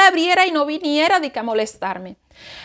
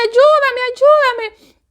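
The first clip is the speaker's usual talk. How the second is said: high, strained cries for help; high panicked plea to her husband; desperate pleading; 10 semitones higher, 5 dB louder, much slower than usual. Retold or imitated. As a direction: beg him frantically, voice way up. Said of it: "y yo de".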